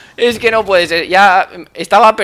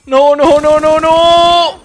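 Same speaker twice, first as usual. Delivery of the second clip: panicked; 7 semitones higher, 6 dB louder, much slower than usual. Real, not acted. Panicking as enemy approaches.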